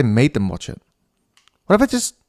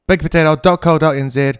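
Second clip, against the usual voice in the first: ad read; 4 semitones higher, 5 dB louder than usual. Acted. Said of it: "I'm halfway through an ad".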